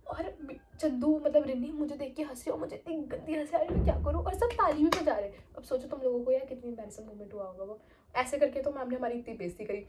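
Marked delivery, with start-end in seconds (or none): none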